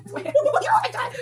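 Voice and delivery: high, slow laughing gasp; overjoyed laughter at her new bag; giddy laugh. Real, not acted. Thrilled unboxing dream bag.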